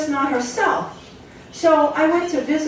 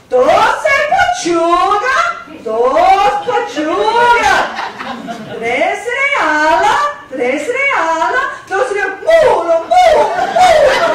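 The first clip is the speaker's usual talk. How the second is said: high, loud, slow Spanish ordering; Spanish-accented order; 9 semitones higher, 8 dB louder, slower than usual. Retold or imitated. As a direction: call the order out piece by piece. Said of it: "didn't speak much English".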